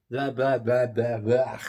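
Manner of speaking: loud run of nonsense blahs; tongue-tied blahs. Real, not acted. Host shaking off own stumbled words, mock babble. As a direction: blurt out blahs to shake off a fumble.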